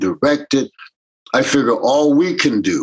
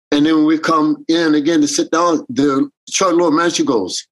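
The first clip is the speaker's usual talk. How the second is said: normal talking voice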